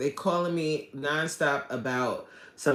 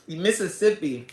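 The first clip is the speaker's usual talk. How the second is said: normal talking voice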